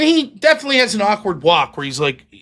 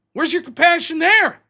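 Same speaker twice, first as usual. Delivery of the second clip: ordinary talk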